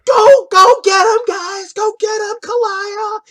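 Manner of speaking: very high cheering voice; shrill cheer for her sibling; sister screaming. Acted. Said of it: "your sister screaming".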